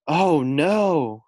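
alarmed. Acted. Frog sees fisherman coming.

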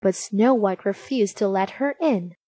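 ordinary delivery, nothing marked

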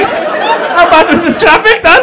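high, loud teasing question; mock concern. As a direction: drag out the mock-incredulous question slowly. Mocking Dan's supposed long drive.

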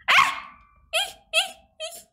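high short squeals; frightened squeals. Real, not acted. Player fleeing in game, high frightened squeaks. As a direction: squeal in little panicked bursts.

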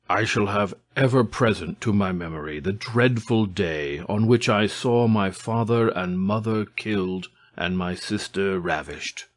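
usual speaking voice

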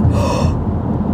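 sudden startled intake of breath; sharp alarmed gasp. Real, not acted. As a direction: gasp in shock at the clock. Sees the time, late.

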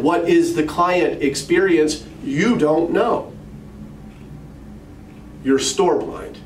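stern. Retold or imitated. Mentor confronts retold manager.

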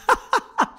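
high shrieking laugh; hysterical laugh. Real, not acted. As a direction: burst into wild laughter. Cracks up at own joke.

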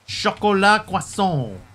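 high, loud, slow mock-French; hammed-up French flourish; pretentious French accent. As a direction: belt out an overblown French pronunciation. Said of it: "Like, really pretentious?".

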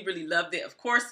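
ordinary talk

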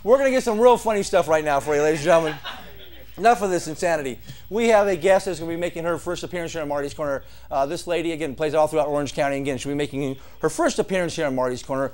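quick, quiet club emcee patter; fast emcee intro. Acted. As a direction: rattle off the intro like a host. Host introduces next act.

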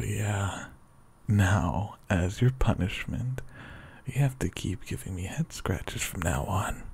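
usual speaking voice

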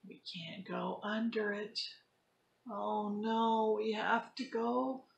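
lively storybook read-aloud; reading to children. Acted. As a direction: act the story out for kids. Teacher reads picture book to class.

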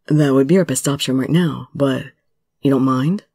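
lightly voiced low, hesitant man; a bit reluctant. A narrator's reading. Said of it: "Subaru asked a bit reluctantly".

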